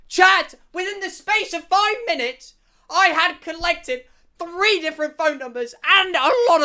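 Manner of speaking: high, loud, grandstanding announcement; triumphant shout. Real, not acted. Telling chat his five-minute tally, triumphant shouting. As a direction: proclaim it to the crowd at full excitement.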